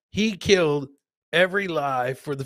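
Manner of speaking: ordinary delivery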